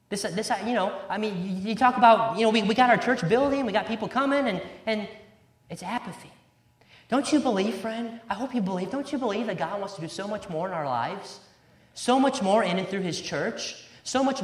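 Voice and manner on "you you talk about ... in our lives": ordinary talk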